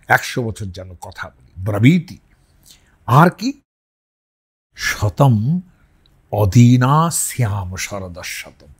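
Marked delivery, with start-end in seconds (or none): 4.8-8.7 slow Sanskrit chant; mantra recitation. Shown as a demonstration. Teacher chanting a Vedic blessing, slow and measured. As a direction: intone the verse slowly.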